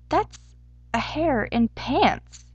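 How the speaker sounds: slow, deliberate declaration after a breath; declaring. A narrator's reading. Hare to the group on seeing the weird creature, declaring slowly. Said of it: "he declared".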